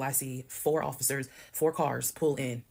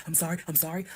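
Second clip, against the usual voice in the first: mocking apology; 3 semitones higher than usual. Mocking her ex's apology to his face, sarcastic. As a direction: mimic his sorry with a sarcastic rising whine. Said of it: "got the audacity to now be like".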